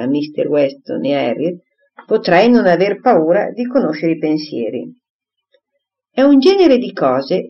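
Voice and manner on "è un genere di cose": high, firm and emphatic; decisive exclamation. A narrator's reading. Mrs Elton bristling at the party, decisive exclamation. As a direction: cut in with firm self-importance. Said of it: "esclamò in tono deciso".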